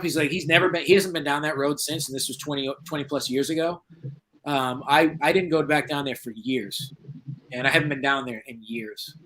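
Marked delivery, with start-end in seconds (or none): none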